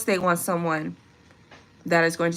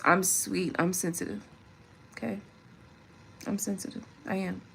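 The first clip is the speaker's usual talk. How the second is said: much softer, tender and confiding; soft admission; 10 dB quieter than usual. Real, not acted. A woman confiding to her man that she's sensitive, softly. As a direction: let it drop to a near hush.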